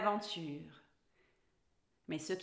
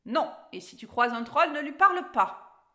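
loud, insistent warning, rising higher; firm order; 5 semitones higher, 9 dB louder than usual. A narrator's reading. Cat forbids Alice to talk to trolls, ordering. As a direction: lay down the rule forcefully. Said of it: "ordonna le chat".

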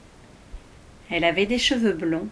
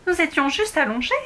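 protesting; 7 semitones higher, 4 dB louder, faster than usual. Acted. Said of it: "protesta Alice".

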